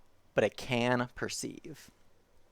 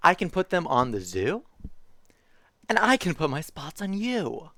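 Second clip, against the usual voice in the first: lively picture-book reading voice; reading to children; 3 semitones higher than usual. Acted. Streamer reading a zoo picture book to chat, bouncy storytime voice. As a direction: read it like storytime for little kids.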